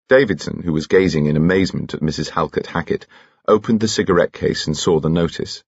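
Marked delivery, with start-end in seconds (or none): none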